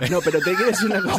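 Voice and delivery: words broken by laughter; laughing. Real, not acted. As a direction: say it while cracking up. Host cracking up mid-joke.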